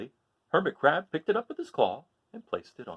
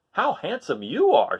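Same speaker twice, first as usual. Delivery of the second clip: louder, slow admiring voice; admiring crab; 5 semitones higher, 5 dB louder, slower than usual. Acted. Hermit Crab admires the starfish, slow and louder. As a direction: linger on the praise. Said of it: "said Hermit Crab".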